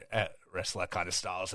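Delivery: ordinary talk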